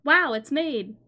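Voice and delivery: excited announcer. Retold or imitated. Mimics the show's intro line.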